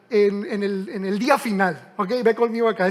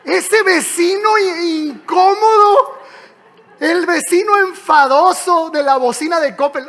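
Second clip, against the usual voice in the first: laughing mockery; 5 semitones higher, 7 dB louder than usual. Real, not acted. Mocking the annoying neighbour.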